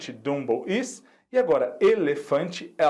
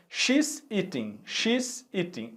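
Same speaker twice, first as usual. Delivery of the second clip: slow read-aloud prompt; 3 semitones higher, slower than usual. Shown as a demonstration. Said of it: "Letter C".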